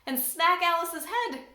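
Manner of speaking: plain reading voice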